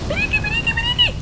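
very high, put-on squeak; silly squeaky chant; squeaky battle cry. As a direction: squeal it like a tiny creature.

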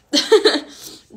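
loud teary laugh breaking out; embarrassed real laugh; burst of real laughter. Real, not acted. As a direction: laugh it off through the tears. Laughing through tears on stream.

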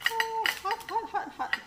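high, loud yelps of hot, hot; yelping at hot food. Real, not acted. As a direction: squeal as the heat stings your fingers. Touching freshly cooked hot food.

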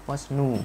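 "new" pronounced close to "noo"; American U vowel. Shown as a demonstration. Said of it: "gần như thành chữ U".

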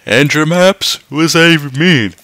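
ordinary talk

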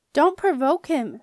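loud, slow, high urgent plea; shout. A narrator's reading. Jenna shouting at the skipper to stop prodding. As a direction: shout the warning slowly and clearly. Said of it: "she shouted".

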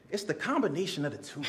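soft throwaway delivery; quiet. Acted. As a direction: drop the voice low and soft. First take, quiet punchline.

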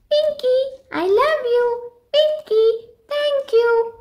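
very high, squawky-bright parrot voice; happy parrot. Acted. The fed parrot thanking Pinky, very high parrot voice.

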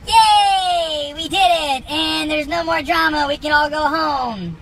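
high, hammed-up cheer; mock cheer. Crew cheering after unloading the storage unit, in a hammed-up high cheer. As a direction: celebrate like kids, sarcastically.

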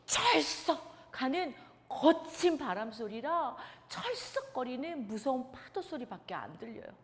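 plain reading voice

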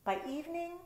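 ordinary delivery

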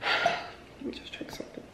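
sigh, then whisper softly; tired whisper to herself; whispered aside. Real, not acted. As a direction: breathe it out under your breath. Sighing aside, very soft.

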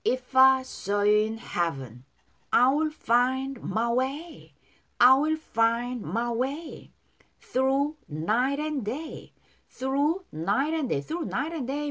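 very slow, drawn-out English phrases; slow model reading. Shown as a demonstration. Teacher models song lyrics for learners, slow and drawn out. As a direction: stretch each word for learners to follow. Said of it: "이 절 발음 따라서".

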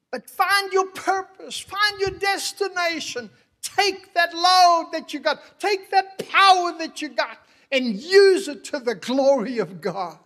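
loud, high pulpit proclamation; impassioned, ringing shout; full-force preaching. Real, not acted. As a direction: declare it to the whole church at full force.

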